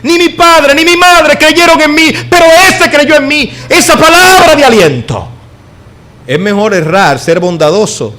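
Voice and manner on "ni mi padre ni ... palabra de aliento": high, loud preacher's cry; fervent preaching. Acted. Pastor preaching to the congregation, fervent cry. Opening preaching at full force.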